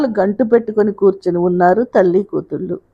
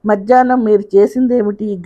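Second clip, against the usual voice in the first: plain reading voice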